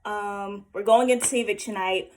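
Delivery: ordinary talk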